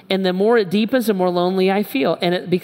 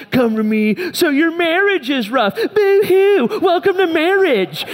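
high-pitched, louder mock sympathy; mad, mocking sneer; 10 semitones higher, 4 dB louder than usual. Pastor mentally answering couples with rough marriages, mad and mocking. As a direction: jeer at their troubles in a hammed-up high voice. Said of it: "I was mad at you guys".

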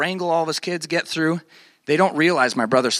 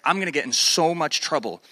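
ordinary delivery

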